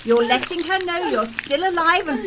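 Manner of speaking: gleeful taunt. Acted. Taunting the screaming girl.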